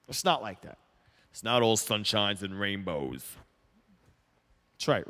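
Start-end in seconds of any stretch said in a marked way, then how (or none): 1.3-3.2 low, slow, badly done tough boxer voice; terrible Rocky imitation. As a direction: drop the voice low and drag out a clumsy boxer impression. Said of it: "That was a terrible Rocky imitation".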